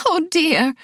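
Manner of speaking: startled cry of a flustered lady; high alarmed oh dear; woman crying out. A narrator's reading. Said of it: "a woman cried".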